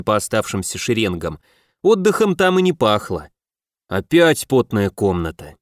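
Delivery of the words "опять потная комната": grumbling. A narrator's reading. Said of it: "недовольно пробурчал".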